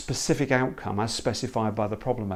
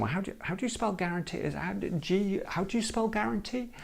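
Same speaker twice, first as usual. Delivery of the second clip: mock baffled, higher and softer, hurried; confused problem owner; 8 semitones higher, 7 dB quieter than usual. Facilitator imitating a baffled problem owner, lightly mock-confused. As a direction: play the flustered owner fumbling the spelling. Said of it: "people saying".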